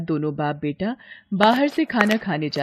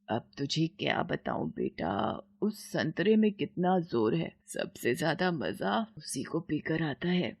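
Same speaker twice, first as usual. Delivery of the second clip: inner thought; 7 dB quieter than usual. Acted. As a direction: murmur it to herself, unheard. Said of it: "अपने मन में सोचती है".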